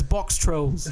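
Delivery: put-on cartoonish Box Trolls accent. Shown as a demonstration. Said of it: "say in that accent".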